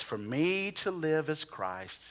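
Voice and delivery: slow, soft reading of the verse; scripture reading. Acted. Preacher quoting Philippians to the congregation, slow and soft. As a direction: read the verse softly and slowly.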